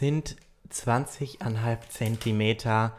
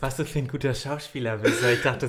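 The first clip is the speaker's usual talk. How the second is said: normal talking voice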